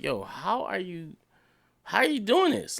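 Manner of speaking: imitating a viewer who can't believe it; amazed disbelief. Retold amazed viewer reaction.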